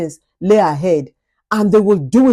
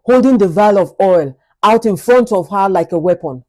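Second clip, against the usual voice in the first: ordinary delivery, nothing marked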